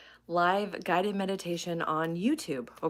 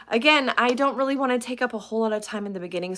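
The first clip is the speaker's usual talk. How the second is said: usual speaking voice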